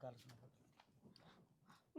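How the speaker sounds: slow hushed whisper to his daughter. Acted. Father drawing his daughter aside, slow whisper. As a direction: lean in and whisper it, very slowly.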